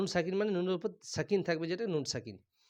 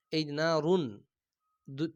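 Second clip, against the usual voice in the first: normal talking voice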